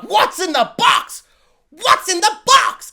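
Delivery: slow, anguished movie shout of "What's in the box?"; Brad Pitt in Seven. Retold or imitated. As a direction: yell it like a man begging to know what's inside. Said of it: "like I'm Brad Pitt and Seven being like".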